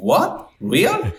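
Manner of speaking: sudden high "What?", shocked disbelief; shocked clients. Retold or imitated. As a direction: imitate a stunned client hearing the price. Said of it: "they, they're like".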